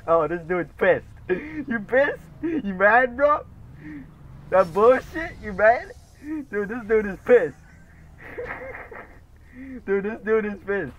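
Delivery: high, loud laughing taunts; mocking taunt. Real, not acted. Laughing at an angry opponent in chat, high and loud taunting. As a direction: needle him with high giddy mockery.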